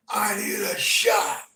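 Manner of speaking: high, slow, loud plea for a shot; desperate yell. Retold or imitated. Burned man bursting into the ER begging for a shot. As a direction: belt it out like you're burning.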